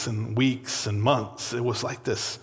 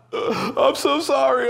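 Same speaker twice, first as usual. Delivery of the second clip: high, slow, choked-up pleading; imitating a sobbing teen saying sorry; tearful apology; 11 semitones higher, slower than usual. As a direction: retell begging through tears, voice pitched up. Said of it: "I'm, like, crying".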